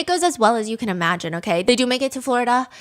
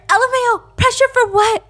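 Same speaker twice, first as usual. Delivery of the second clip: much higher and louder, mocking scoff; 13 semitones higher, 7 dB louder than usual. [name] scoffing at Dom about her dad, mocking high. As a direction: squeal the scoff in disbelief.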